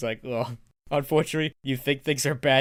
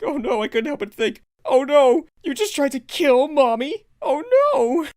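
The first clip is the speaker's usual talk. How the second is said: mock panic; 10 semitones higher, 7 dB louder than usual. Imagining Cadance nearly zapped.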